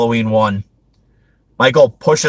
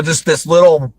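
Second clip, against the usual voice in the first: normal talking voice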